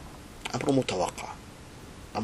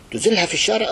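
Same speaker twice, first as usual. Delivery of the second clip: repeated much higher and louder; outraged shout; 11 semitones higher, 6 dB louder, faster than usual. Shown as a demonstration. Preacher denouncing the tyrant to the crowd, outraged shout. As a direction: cry it out in outrage.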